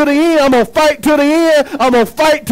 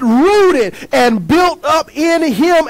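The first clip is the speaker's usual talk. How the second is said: slow emphatic reading; 4 semitones higher, much slower than usual. Shown as a demonstration.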